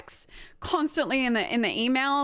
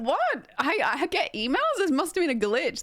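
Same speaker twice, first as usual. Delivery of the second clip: higher, feigned innocent surprise; mock bewilderment; 6 semitones higher than usual. Streamer jokes to accountants about emails, mock baffled. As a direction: play dumb, as if baffled.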